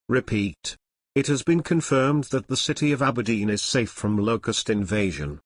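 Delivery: usual speaking voice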